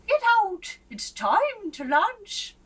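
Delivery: high, loud, slow queenly order; queen calling the king to lunch, high and loud; bossy queen. A narrator's reading. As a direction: command him out like a queen.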